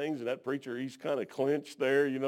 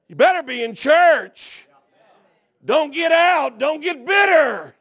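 loud, higher, slow fiery preaching; preaching at full force; 10 semitones higher, 13 dB louder than usual. Acted. Preacher warning the young families, loud fiery preaching. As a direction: hammer the warning home to the church.

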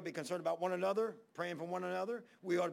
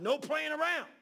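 firm urging; 9 semitones higher, 6 dB louder than usual. Retold or imitated. Voicing himself urging Rob and Ashley.

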